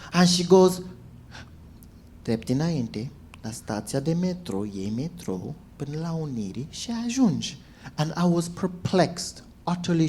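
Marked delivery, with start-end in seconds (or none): 2.2-7.6 soft, lower imitation of a woman answering; imitated subdued reply in Romanian; meek directions. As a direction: give directions quietly and obediently. Grabbed old woman calmly gives directions.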